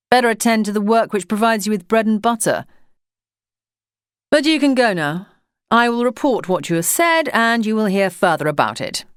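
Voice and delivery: a lofty official sneering at a postman who refuses Sunday work; ugly sneer. Acted. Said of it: "an ugly sneer in the way he pronounced the words".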